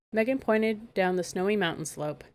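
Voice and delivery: plain reading voice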